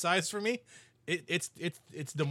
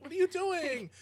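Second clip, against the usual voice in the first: high-pitched incredulous scolding; mock exasperation; 14 semitones higher than usual. Host still scolding oblivious twerkers, mock exasperation. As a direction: demand it in mock disbelief. Acted rebuke continues.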